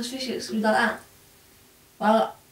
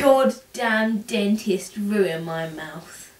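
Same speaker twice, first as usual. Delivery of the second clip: dragged-out complaint; 3 dB louder, much slower than usual. Real, not acted. Complaining about dentist.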